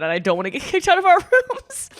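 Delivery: high, nervous laughing squeak; laughing panic. Retold or imitated. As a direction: laugh through the fear of being kicked out. Retelling her scared inner thoughts.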